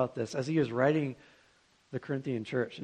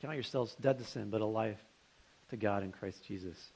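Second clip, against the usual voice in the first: soft repeat; 6 semitones lower, 9 dB quieter than usual. Shown as a demonstration. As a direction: let it sink in quietly. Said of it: "repeated for emphasis".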